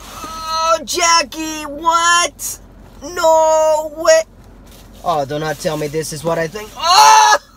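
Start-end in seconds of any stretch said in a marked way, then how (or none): 0.0-4.2 high, loud disbelieving squeals; excited squeal. Real, not acted. Opening exciting fan mail. 6.8-7.5 very high, loud shriek of delight; excited shriek. Real, not acted. Opening exciting fan mail.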